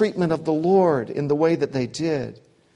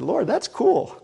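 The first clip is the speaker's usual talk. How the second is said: mock amazement; 6 semitones higher, slower than usual. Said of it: "and he said".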